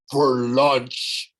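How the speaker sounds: a lightly voiced growl of a monster; a hint of a menacing growl. A narrator's reading. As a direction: rumble it out as a hungry giant, mildly. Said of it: "Agrias growled".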